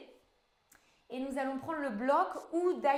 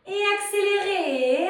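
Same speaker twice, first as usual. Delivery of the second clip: loud, high call to speed up; raised, energetic instructor's cue; rallying coach call; 6 semitones higher, 11 dB louder than usual. Real, not acted. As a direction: call it out to drive the class on. Coach calls for a faster tempo.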